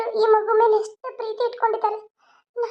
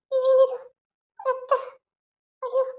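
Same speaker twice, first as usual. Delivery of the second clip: frightened gasp; 3 semitones higher than usual. Acted. Wife alone in her room finds blood, sobbing in fear.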